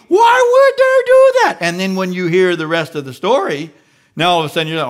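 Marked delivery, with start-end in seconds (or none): 0.1-1.6 much higher, loud indignant shout; hammed-up outraged shout; loud, high cocky "Why would they do that?". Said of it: "yesterday you were all cocky".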